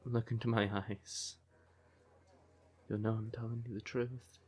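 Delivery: soft, intimate declaration; tender. Acted. Siren declaring love to a doubting partner, tender. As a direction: confide it softly and sincerely.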